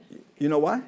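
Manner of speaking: ordinary talk, nothing marked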